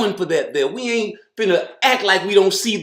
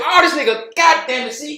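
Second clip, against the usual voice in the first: normal talking voice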